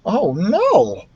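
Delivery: high little mouse voice in dismay; mouse's dismay. A narrator's reading. Ralph the mouse echoing the mother, high dismay. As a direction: echo the dismay in a tiny voice.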